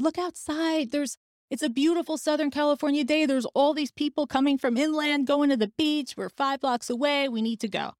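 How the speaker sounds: urging, coaxing. Retold or imitated. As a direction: retell his pitch to get outside, voice lifted. Husband coaxes her out to beach.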